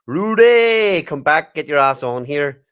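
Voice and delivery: loud, high call across the room; calling out loudly. Real, not acted. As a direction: shout his name to summon him. Calling to a listener to join.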